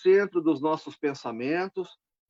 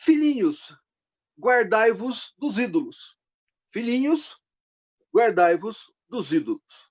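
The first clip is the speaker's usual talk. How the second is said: raised, loud voice on each short phrase; emphatic scripture reading; 4 semitones higher, 4 dB louder than usual. Acted. Preacher reading John's closing warning, loud and deliberate. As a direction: proclaim the verse slowly, with clear pauses. Reads closing verse to congregation.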